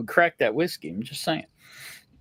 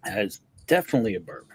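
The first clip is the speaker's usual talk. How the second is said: quick, much lower mock repeat; mocking echo; 9 semitones lower, 4 dB quieter, much faster than usual. Host teasing his co-host by echoing him low and fast. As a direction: parrot his answer back in a low teasing voice.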